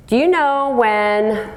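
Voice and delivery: normal talking voice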